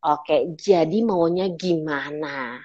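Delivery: low, soft, very slow challenge; soft warrior. Shown as a demonstration. Coach to someone going in circles, soft warrior. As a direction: confront gently, drawing each word out slowly. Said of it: "saya pakai soft warrior".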